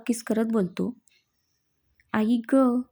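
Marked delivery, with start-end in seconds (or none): none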